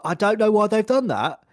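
high, loud mock Cockney; terrible British accent. Mimicking the actor's daft line, terrible British accent. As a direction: put on a hammed-up Cockney accent. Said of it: "terrible British accent".

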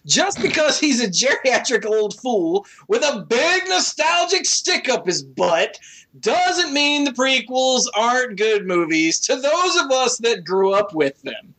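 usual speaking voice

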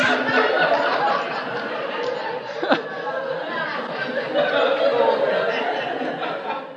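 wheezing, breathless giggle fit; long helpless laugh. Real, not acted. Preacher at the pulpit, overcome by a giggle fit. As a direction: lose it completely, then fight to recover.

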